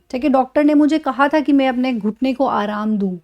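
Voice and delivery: ordinary talk, nothing marked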